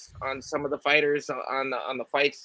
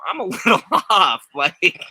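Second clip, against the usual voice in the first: laughing; 4 semitones higher, 7 dB louder than usual. Real, not acted. Laughing at own quirkiness.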